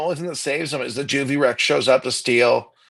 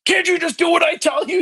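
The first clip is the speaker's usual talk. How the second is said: exasperated Alan Grant; 13 semitones higher, 3 dB louder than usual. Retold or imitated. Alan Grant scolding the kids.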